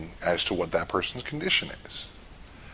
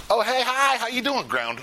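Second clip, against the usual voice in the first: high, chirpy brush-off greeting; wrong way, chirpy; 14 semitones higher than usual. Shown as a demonstration. Showing the class the careless chirpy greeting. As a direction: bounce up too cheerfully. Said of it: "Don't just go up".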